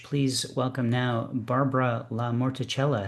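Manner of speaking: usual speaking voice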